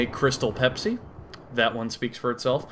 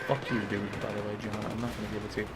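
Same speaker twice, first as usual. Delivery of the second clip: low, much softer grumble; muttered aside; 4 semitones lower, 11 dB quieter, faster than usual. Pretend. Comic addresses the imagined man at his window, muttered aside. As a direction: grumble it under your breath.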